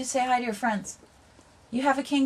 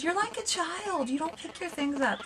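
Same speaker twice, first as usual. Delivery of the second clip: imitated complaining; 8 semitones higher, 6 dB quieter than usual. Retelling partner's complaint about bangles, mock complaining. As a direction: mimic a complainer in a higher, softer voice. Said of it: "And you were complaining. You said".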